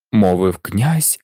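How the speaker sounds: usual speaking voice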